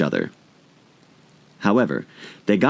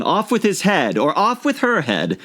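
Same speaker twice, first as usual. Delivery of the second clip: higher, furious queen; shouting queen; 10 semitones higher than usual. A narrator's reading. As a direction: rage at the players. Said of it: "stamping about and shouting".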